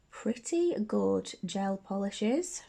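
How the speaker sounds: ordinary delivery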